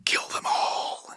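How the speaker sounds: slow hissed whisper of an evil voice; voicing the entity's slow, creepy whisper in his ear. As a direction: breathe it like a demon in his ear. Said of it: "an evil entity that keeps whispering".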